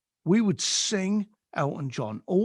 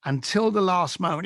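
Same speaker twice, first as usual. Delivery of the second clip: usual speaking voice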